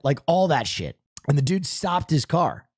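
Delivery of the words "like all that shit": ordinary talk, nothing marked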